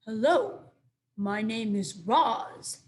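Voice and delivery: slow, measured robot voice; mimicking the robot. A narrator's reading. Owl imitating the robot's words, slow and stiff. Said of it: "it was saying".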